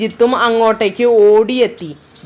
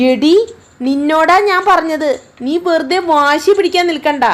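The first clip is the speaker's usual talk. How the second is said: higher, mildly irritated scold; a little angry; 6 semitones higher than usual. Acted. Jani scolding her stubborn sister, a little angry. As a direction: snap at a stubborn sister, a bit cross. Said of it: "Jani അല്പം ദേഷ്യത്തോടെ പറഞ്ഞു".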